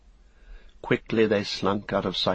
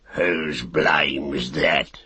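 very slow, low grumbling orc; orc soldier; 3 semitones lower, much slower than usual. Acted. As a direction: drag it out like a surly brute. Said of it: "said the soldier".

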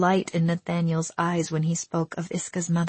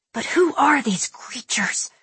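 fierce; 7 semitones higher than usual. A narrator's reading. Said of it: "he asked fiercely".

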